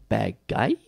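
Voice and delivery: lightly imitated Zangief accent; a hint of Zangief's movie accent; little character accent. Host correcting whose movie line it was, little Zangief accent. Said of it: "says it with his little accent".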